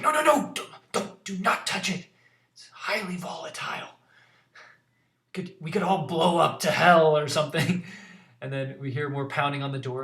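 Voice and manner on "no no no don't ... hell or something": higher, louder urgent warning; panicked leader stopping a disaster; Ballister alarmed. Acted. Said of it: "He's like".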